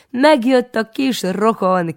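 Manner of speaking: high, loud, slow proud shout; calling out from afar. A narrator's reading. Proud aunt shouts across the market, high and loud. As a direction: announce him to the whole street. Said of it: "kiabálja már messziről".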